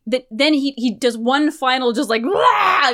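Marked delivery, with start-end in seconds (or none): 2.2-2.9 high mock wail, short and wild; hammed-up battle wail; uncaged wail. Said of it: "this uncaged wail".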